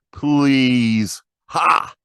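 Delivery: snorting contempt in the margin; dismissive scoff. Acted. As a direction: wave it away with a scoffing "Ha!". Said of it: "more offhand, dismissive".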